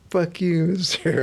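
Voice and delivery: high imitated bratty kid; mock rude kid. Kids talking back to the clerk, high bratty mock. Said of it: "he's used to kids just like".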